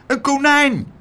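very high, loud cry of discovery; calling out. A narrator's reading. Abeltje spots a rabbit, calling out. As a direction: exclaim it high and amazed. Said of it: "riep Abeltje".

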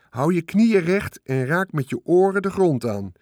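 high, loud called instruction. A narrator's reading. Charlotte calls instructions to Wilbur, loud call. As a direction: call it out to the pig. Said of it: "riep Charlotte".

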